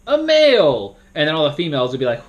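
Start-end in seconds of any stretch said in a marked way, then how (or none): 0.1-1.0 high, dragged-out dramatic announcement; suspenseful moderator. Shown as a demonstration. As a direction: build suspense like a game host. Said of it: "you don't wanna make it very suspenseful".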